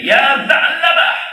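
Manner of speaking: long, slow, high call across the hills. Acted. Umar calling the hiding boy, slow and drawn-out. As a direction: stretch the name out as a far call. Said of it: "diseru, dipanggil".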